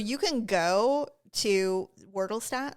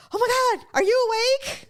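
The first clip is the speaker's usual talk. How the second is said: excited; 13 semitones higher, 5 dB louder than usual. Retold or imitated. Waking husband at 4 a.m.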